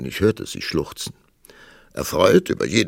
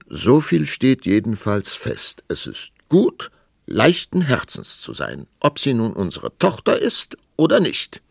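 raised, louder, firm pronouncement; pompous king; 7 semitones higher, 6 dB louder than usual. A narrator's reading. King stating his view to queen, pompously. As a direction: lay it down as a ruling.

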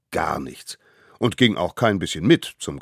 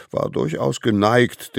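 plain reading voice

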